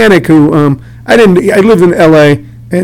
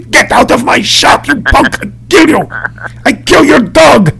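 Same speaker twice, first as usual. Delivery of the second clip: higher, hammed-up threats from an angry mechanic; mock angry shop owner; 7 semitones higher than usual. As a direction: yell like a furious mechanic, but for laughs.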